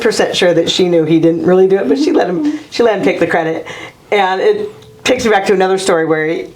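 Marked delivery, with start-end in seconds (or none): none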